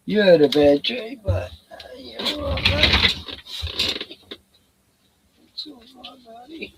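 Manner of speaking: high sing-song voice for a dog; pet-talk voice. Real, not acted. Owner comforts his dog after a bad dream, high pet-talk voice.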